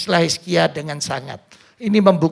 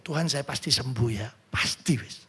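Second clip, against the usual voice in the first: whispered, sure prayer; 6 semitones lower, 12 dB quieter than usual. Shown as a demonstration. Preacher shows the congregation a sure prayer, whispered. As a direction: whisper it like a private confident prayer.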